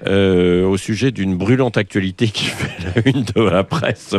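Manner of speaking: straight, slow radio lead-in without irony; slow and measured, playing it completely straight; serious interview tone. Real, not acted. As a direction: introduce it earnestly, like a sober news topic. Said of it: "vous avez lancé ma chronique sur le ton".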